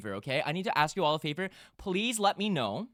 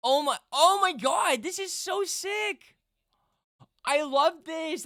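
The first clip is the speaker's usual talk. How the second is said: excited; 9 semitones higher, 4 dB louder, slower than usual. Real, not acted. Excited reaction to fan art.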